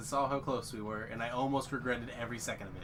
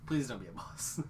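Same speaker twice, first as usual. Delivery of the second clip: ordinary talk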